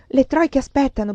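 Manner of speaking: shout. Acted. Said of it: "Grigorievsky gridò".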